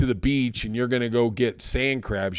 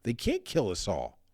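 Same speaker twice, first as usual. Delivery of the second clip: higher, softer voice of disbelief; disbelieving plea; 6 semitones higher, 6 dB quieter than usual. Retold or imitated. Prisoner on the train insists they can't kill everyone, pleading. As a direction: plead that it cannot happen, quietly. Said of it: "one of them looks at him and goes".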